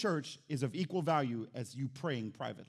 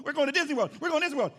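higher, louder chant of "We're going to Disney World"; excited kid; 8 semitones higher, 7 dB louder, much faster than usual. Retold or imitated. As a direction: bounce with a six-year-old's thrill. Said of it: "my adrenaline was pumping".

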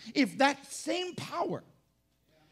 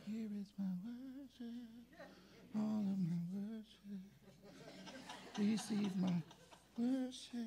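very soft, distant little praise; timid worship; 3 semitones lower, 15 dB quieter, slower than usual. Acted. Churchgoer praising once outside church, hushed and timid. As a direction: barely let the praise out, drawn out and hushed.

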